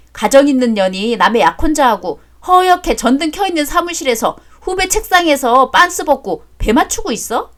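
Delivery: ordinary talk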